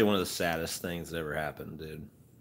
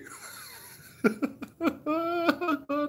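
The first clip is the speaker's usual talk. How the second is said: high cartoon dog giggle; Scooby laugh; 4 dB louder than usual. Streamer finishing the Scooby bit with a high cartoon laugh. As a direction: cackle like Scooby-Doo.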